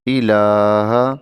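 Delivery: ordinary talk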